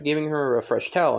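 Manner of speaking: ordinary delivery, nothing marked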